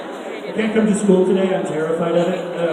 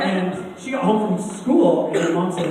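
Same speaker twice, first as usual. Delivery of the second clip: ordinary delivery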